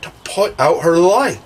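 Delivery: imploring storybook request; begging. A narrator's reading. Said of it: "Wendy begged".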